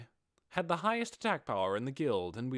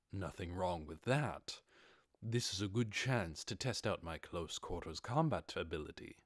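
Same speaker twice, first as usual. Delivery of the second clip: lower, softer, a touch sinister; lightly voiced calm knight; 5 semitones lower, 4 dB quieter than usual. Ainz relishing a worthy opponent, a low soft hint of menace. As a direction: murmur it with a hidden grin.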